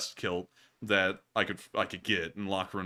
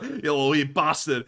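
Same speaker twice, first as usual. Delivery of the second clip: higher, louder mock Scottish insult; Scottish accent; 4 semitones higher, 6 dB louder than usual. Host finishing the joke threat in put-on Scottish. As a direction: fling a joking Scots insult. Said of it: "I can't do the Scottish accent".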